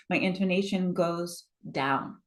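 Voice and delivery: DOWN dropped at the end as a finished statement; falling end on DOWN. Shown as a demonstration. Coach showing the class down gliding, DOWN falls. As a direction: let the pitch glide down on DOWN. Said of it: "I went up and down".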